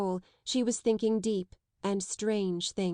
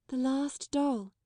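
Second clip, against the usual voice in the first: slow, mournful, higher; 5 semitones higher, slower than usual. A narrator's reading. Sarah to the headmistress, slow and mournful. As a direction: let it sink, sadly. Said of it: "her little mournful voice".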